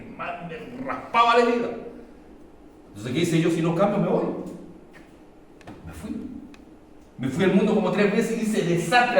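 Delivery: loud, high, driving sermon voice; full-force preaching. Acted. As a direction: preach it out to the whole church. (predicación a todo pulmón)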